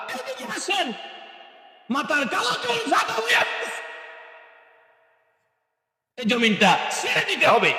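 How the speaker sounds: very high, straining preacher's cry; high-pitched exhortation. Real, not acted. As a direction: exhort the congregation at the top of the range. Preacher at full force. (চড়া গলায় নসিহত)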